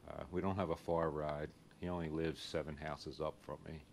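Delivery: normal talking voice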